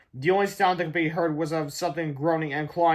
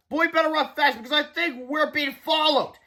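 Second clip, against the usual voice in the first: high, urgent voice; alarmed warning; 10 semitones higher than usual. A narrator's reading. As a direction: warn a friend of pursuers. Warning of pursuit.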